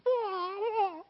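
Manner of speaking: piercing high kung fu cry; playful imitation of a movie fighter's yell; Bruce Lee cry. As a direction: let out a martial-arts shriek. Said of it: "I practiced that sound really hard".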